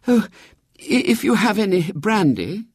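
high, faltering request; weakly stammered, pitched high; faint. A narrator's reading. Queasy mother asking for brandy after the crossing, faintly.

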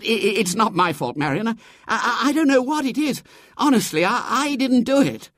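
high-pitched, stammering excuse; gasping protest. A narrator's reading. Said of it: "gasped Robert".

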